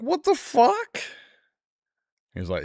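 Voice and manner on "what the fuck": much higher, louder stunned cry of disbelief; appalled outburst at a grandfather's bragging; shocked disbelief. Retold or imitated. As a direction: react like you can't believe what you just heard. Reacting in disbelief to grandpa's story.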